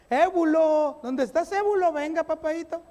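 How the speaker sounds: put-on high, loud call across the house to a little boy; calling a child. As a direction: call Ébulo in like a fond parent. Parent calling a child named Ébulo.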